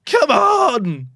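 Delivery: high, loud urging shout; will the ball in, loud and high; excited cheer. Real, not acted. Streamer urging his team on, loud high cheer.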